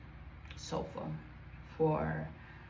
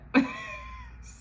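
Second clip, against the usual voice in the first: high disbelieving laugh. Real, not acted. Laughing at grandmother's promise.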